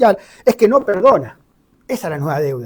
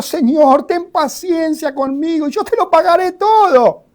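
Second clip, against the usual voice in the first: higher, earnest begging servant; pleading servant; 8 semitones higher than usual. Acted. Servant begging his master for patience, pleading. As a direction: beg the master for more time. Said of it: "le suplicaba diciendo".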